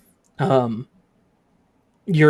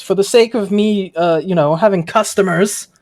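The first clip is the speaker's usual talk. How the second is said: shopkeeper voice; 5 semitones higher, 3 dB louder than usual. Acted. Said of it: "reaches underneath the table and goes".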